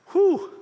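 high, breathy whistle of disbelief; wide-eyed "whew". Retold or imitated. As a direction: blow out a big "whew" at the salty crowd. Reacting to hockey crowd language.

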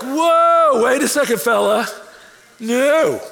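high, loud startled whoa; mock alarm. Dad startled by his son's plan to live with him, loud. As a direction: pull back in comic alarm.

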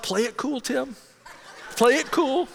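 starstruck self-coaching. Retold or imitated. Pastor to himself on meeting his idol, starstruck self-coaching. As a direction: coach yourself to play it cool, high and tense.

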